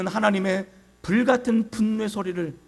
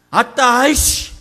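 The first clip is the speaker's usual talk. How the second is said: loud, high accusing shout; forceful, raised-pitch cry; fiery Hebrew outcry; 5 semitones higher, 9 dB louder, slower than usual. Acted. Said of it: "불같은 나단의 외침".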